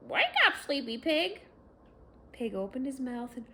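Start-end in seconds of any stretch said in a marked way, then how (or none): 0.1-1.4 loud, high wake-up call; rooster calling out. A narrator's reading. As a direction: call across the farmyard to rouse Pig. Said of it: "Rooster called out".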